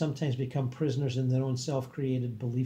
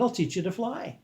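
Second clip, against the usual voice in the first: confident; 8 semitones higher than usual. Acted. Narrator voices himself reassuring.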